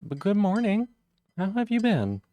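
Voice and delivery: high sing-song good morning; cheery greeting. Real, not acted. Streamer welcoming a viewer with a high, cheery good morning. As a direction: lift the voice up to welcome them.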